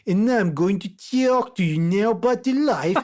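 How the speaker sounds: slow, high-pitched, hammed-up Eastern European accent; exaggerated Eastern European accent. Shown as a demonstration. Mocking a video's put-on accent by introducing himself, exaggerated Eastern European accent. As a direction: parody a thick Eastern European accent, drawn out. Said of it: "imagine if I did this".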